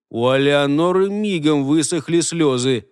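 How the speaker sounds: plain reading voice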